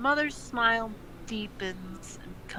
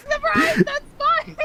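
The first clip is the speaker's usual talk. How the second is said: laughing; 8 dB louder than usual. Real, not acted. Laughing reaction to joke.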